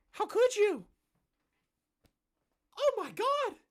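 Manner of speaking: very high, whiny falsetto; mock outrage. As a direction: shriek it like a wounded hypocrite. Mocking the forum's outrage.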